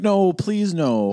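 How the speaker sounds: high, mock-pleading wail; pleading listeners. Imagined listeners begging the hosts, mock pleading. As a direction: beg them to stop in a high voice. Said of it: "People, people listening going".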